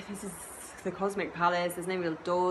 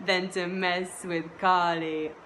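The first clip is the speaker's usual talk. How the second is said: usual speaking voice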